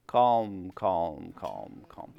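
slow settling calm calm calm. Retold or imitated. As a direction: soothe it down to almost nothing. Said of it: "stay calm".

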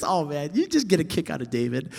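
usual speaking voice

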